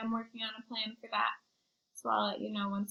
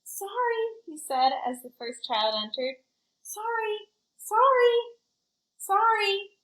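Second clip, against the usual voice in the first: apologetic school; 10 semitones higher, 6 dB louder, slower than usual. Acted. School apologizes to returning children.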